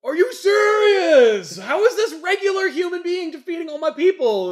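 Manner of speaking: villain protesting while laughing at his own losing side; outraged disbelief. Retold or imitated. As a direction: rant it like a flustered villain, laughing. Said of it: "Protex is like".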